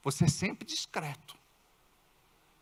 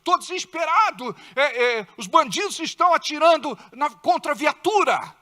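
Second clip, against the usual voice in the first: high, loud, desperate pleading; a mock-panicked officer begging for orders; panicked cry for help; 7 semitones higher, 7 dB louder than usual. Police officer panicking on the radio to headquarters, loud desperate voice. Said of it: "ligar pro quartel ou pro superior e dizer".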